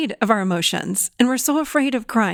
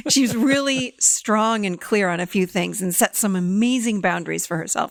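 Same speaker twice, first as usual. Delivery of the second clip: ordinary talk, nothing marked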